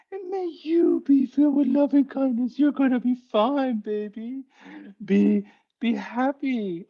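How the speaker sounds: put-on very high, syrupy baby-talk pity; pitying sweet talk. Shown as a demonstration. Teacher showing the wrong pitying loving-kindness, high baby talk. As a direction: coo it down at them like to a baby. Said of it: "You don't wanna say".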